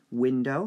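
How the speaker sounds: window said with WIN hit loud; teacher saying window with stress on WIN; first syllable stressed. Shown as a demonstration. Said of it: "how many syllables?".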